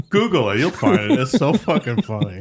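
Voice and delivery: ordinary talk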